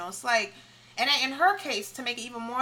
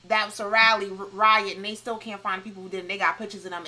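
ordinary talk

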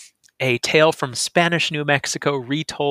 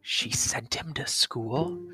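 much softer, slow hushed disbelief; whispered; 8 dB quieter, slower than usual. Acted. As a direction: hush it to your partner. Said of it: "they whispered".

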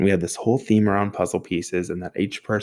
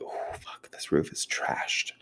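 slow, hushed whisper to yourself; dismayed whisper; 9 dB quieter, slower than usual. Retold or imitated. Driver seeing the trashed van roof, dismayed whisper. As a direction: whisper it, taking in the damage slowly.

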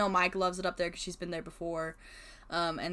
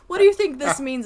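higher and louder, needling question; mocking friends; 8 semitones higher, 12 dB louder than usual. Friends pointing at trash cans asking the art major, mock-earnest. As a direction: tease like a pestering friend. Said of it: "And they're like".

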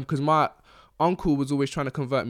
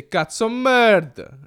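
"cazzo merda" louder, higher and slow; mock Italian insult; 8 semitones higher, 10 dB louder, much slower than usual. Showing the worse insult he held back, loud and slow. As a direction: drag out the insult with relish. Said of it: "he's lucky I didn't say".

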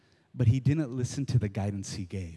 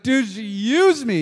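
louder, higher, slow whine; whiny complaint; 9 semitones higher, 8 dB louder than usual. Retold or imitated. Buddy complaining he was used, high slow whine. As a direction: moan it like a hurt buddy. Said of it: "he's like".

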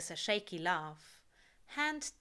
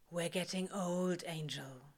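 quiet, tender, trailing off; soft and fond; 2 semitones lower, 3 dB quieter than usual. A narrator's reading. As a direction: speak it fondly, almost to yourself.

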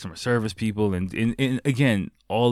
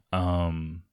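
ordinary delivery

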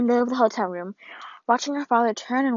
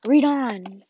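strange, forceful creature whisper; dark, otherworldly urging; whispering dark thing; 3 dB louder than usual. Acted. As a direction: whisper a command as a looming dark presence. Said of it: "whispered the dark thing".

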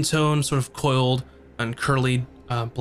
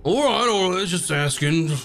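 higher, put-on guard voice backing off; defensive guard; 5 semitones higher than usual. Guard backing off from the adventurers, in a higher put-on voice. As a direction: throw up your hands, just asking.

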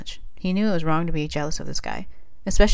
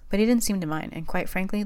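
ordinary delivery, nothing marked

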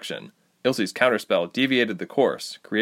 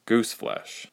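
plain reading voice

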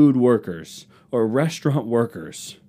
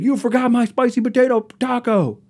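much higher, shrill mock rant; mock furious customer; 12 semitones higher, 4 dB louder than usual. At the Taco Bell drive-thru, a hammed-up angry yell. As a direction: rage like an entitled customer over a taco. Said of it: "be like".

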